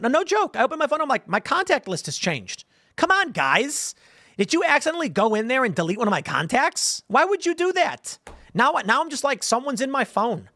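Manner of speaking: high, incredulous mock protest; exasperated. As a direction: plead with the spies in comic outrage. Exasperated complaint to phone spies.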